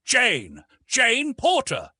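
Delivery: loud, long call through the forest. A narrator's reading. Rescuer calling for Jane through the forest, loud. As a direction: call her name across the trees. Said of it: "her name being called aloud".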